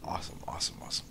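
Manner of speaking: excited hushed whisper. Real, not acted. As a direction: breathe it out in awe. Whispering excitedly at underwater view.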